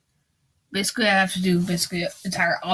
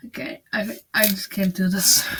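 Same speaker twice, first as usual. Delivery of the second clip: ordinary talk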